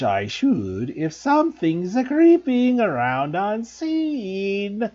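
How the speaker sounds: high, slow taunting poltergeist; sing-song the taunts like a sly ghost; Peeves' voice. A narrator's reading. Peeves taunting invisible intruders.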